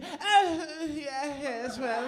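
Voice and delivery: posh English hesitation, looking down; mock condescending Brit; condescending British tone. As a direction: mimic the wife's superior English hums. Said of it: "this sort of condescending tone to it".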